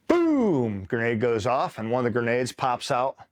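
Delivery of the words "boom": high, loud drawn-out "boom"; imitated explosion. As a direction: mimic the grenade going off, long and high. Imitates grenade blast.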